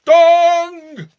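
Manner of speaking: long high loud bong; clock chime. A narrator's reading. Clock striking midnight, a long loud bong. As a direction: ring it out like a big clock bell.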